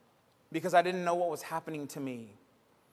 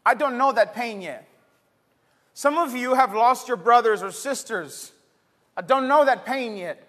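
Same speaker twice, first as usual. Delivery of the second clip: louder, higher preaching voice, rolling phrases; full-force preaching; 7 semitones higher, 8 dB louder than usual. Acted. Pastor rousing the church about uncertainty, full-force preaching.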